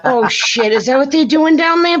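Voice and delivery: normal talking voice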